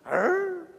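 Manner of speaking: short, high-pitched grr; mock puzzled growl. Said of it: "Jesus sees Zacchaeus and he goes".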